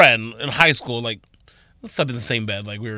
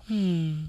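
sleepy sigh; 4 semitones higher, 9 dB quieter than usual. Shown as a demonstration. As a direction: sigh out like half asleep. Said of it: "like a sleepy sigh".

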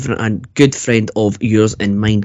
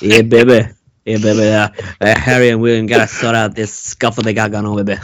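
Dusty impression. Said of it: "a bit of dusty thrown in there".

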